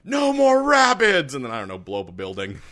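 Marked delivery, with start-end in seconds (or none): 0.1-1.3 loud, high, slow battle cry; shout. Pretend. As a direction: yell it like a war charge. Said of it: "just be like".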